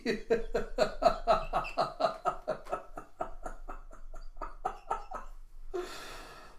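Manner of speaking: high, breathless laughter; real laughing fit. Real, not acted. Teller cracking up at the story, real laughing fit. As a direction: laugh helplessly for several seconds.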